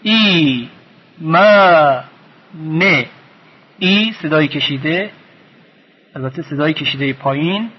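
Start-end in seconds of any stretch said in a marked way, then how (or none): 0.0-3.1 "ای، ما، نه" as three separate beats; syllable by syllable. Shown as a demonstration. Teacher showing each part of the word, syllable by syllable. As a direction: hold "ای" and "ما" long, keep "نه" short. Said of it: "یک بخش هست".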